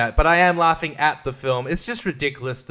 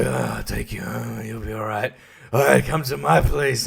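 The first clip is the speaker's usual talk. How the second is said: mock creep voice; 5 semitones lower than usual. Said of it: "and go like".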